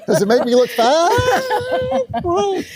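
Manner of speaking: high, joking fake panic; mock alarm. Host joking to co-host about his outfit, mock alarm. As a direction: play up the outfit worry.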